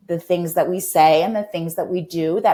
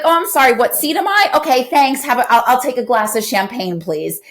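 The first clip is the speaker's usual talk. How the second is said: higher, breezy airline-passenger voice; mocking a contented dieter on the plane, light higher voice; mock polite passenger; 7 semitones higher, 4 dB louder than usual. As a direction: play a cheerful passenger ordering champagne. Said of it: "You're not gonna be like".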